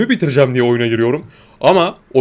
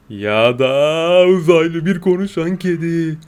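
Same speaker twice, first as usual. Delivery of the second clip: spooky suggestion; 2 semitones higher, much slower than usual. Pretend. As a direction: make it sound eerie and mysterious. Spooky idea of an alien cat.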